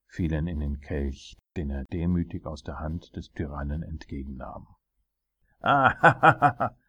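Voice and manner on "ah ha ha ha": loud, high burst of ha-ha laughter; roaring laugh. A narrator's reading. Tyrant laughing at the drinking dwarf, roaring. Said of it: "brüllte letzterer".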